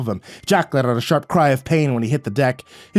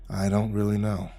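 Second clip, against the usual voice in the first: faint; 4 semitones lower, 7 dB quieter than usual. A narrator's reading. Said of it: "Faintly, Jack said".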